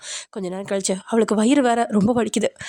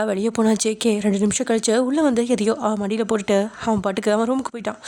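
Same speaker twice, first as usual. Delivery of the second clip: ordinary delivery, nothing marked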